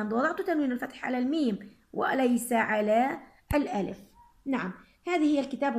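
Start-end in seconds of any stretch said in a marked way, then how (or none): none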